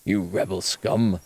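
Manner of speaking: slow, sneering Death Star officer; Imperial officer. Pretend. Cop mocking the old communist like an Imperial officer, slow and sneering. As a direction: drawl it like a smug Imperial officer. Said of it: "I look like an Imperial officer from the Death Star".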